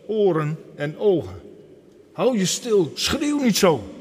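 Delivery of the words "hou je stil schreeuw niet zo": higher, louder, scolding hush; crowd hushing. Acted. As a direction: shut the shouting beggar up, sharply. Crowd hushes the beggar.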